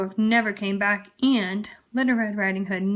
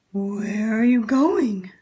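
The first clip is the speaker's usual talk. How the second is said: slow, louder growling wolf; growled; 4 dB louder, much slower than usual. Acted. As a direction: draw the question out like a prowling wolf. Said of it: "growled the wolf".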